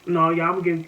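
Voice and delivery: usual speaking voice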